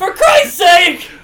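slow, loud, high yell; exasperated shout. Acted. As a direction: bellow it out in frustration. Trapped man yells in frustration.

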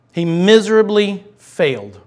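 usual speaking voice

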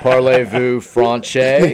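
drawn-out hammy French question; French accent. Pretend. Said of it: "Try to speak French".

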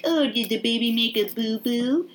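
a hint of babying someone with a boo-boo; mock baby talk. Trevor to screaming Stacy, mock baby talk.

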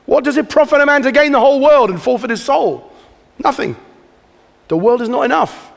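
loud and high, hammering the question home; forceful preaching. Real, not acted. Preacher pressing the congregation on what matters, loud and high. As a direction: drive it home from the pulpit, full force.

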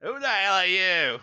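put-on failed New Jersey accent, sounds British; high, bad New Jersey accent. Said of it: "he needs to do, like, a New Jersey accent".

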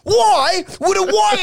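slow, very high demand; mock indignant outcry. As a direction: wail the question in mock disbelief. Acted quarrel, baffled outcry.

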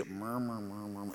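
muttered low and very soft; low murmur. Shown as a demonstration. Preacher demonstrating grumbling as a low murmur. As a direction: murmur it under your breath. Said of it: "in a low tone".

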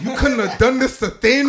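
loud excited yell with a laugh. Real, not acted. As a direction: shout it out, laughing. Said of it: "stop yelling".

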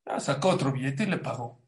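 ordinary talk, nothing marked

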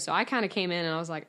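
ordinary talk

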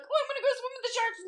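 high, quick mock voice; breezy high-pitched mockery; mocking swimmers. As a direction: imitate clueless thrill-seekers in a high, rushed voice. Mocking reckless shark swimmers.

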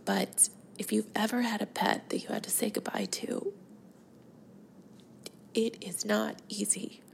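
holding back tears, with long halting pauses; getting teary-eyed. Real, not acted. Podcaster mourning her soulmate cat, close to tears. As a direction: pause and sigh to keep composure. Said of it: "I'm gonna get teary-eyed here".

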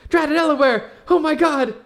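high, loud cry; suddenly remember the lost friends; alarmed realization. Acted. Character remembers her friends fell into hell, high loud cry.

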